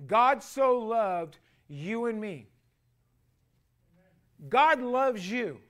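loud, slow declaration; emphatic preaching. Acted. Pastor declaring God's love to his church, slow emphatic preaching. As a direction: land it on the congregation.

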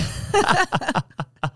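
high burst of laughter at his own joke. Real, not acted. Husband laughing after teasing his wife, high laugh.

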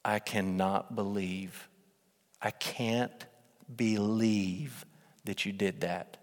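quiet, low disappointed mother; mother's soft, heavy disappointment; disappointed mom. Retold or imitated. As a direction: hush it down like a let-down mom. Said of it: "when your mom looks at you and says".

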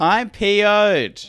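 slow, high grumpy complaint; angry customer. Pretend. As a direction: play an irate caller, dragging it out. Playing angry Apple customer.

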